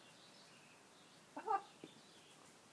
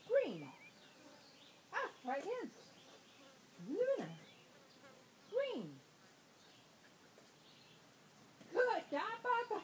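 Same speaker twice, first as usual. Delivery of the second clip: talking to a dog; 9 semitones higher than usual. Real, not acted. Commands and praise to dog.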